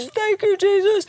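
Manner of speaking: a crying woman squeaking out her thanks; tearful thanks. Retold or imitated. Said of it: "She's just crying".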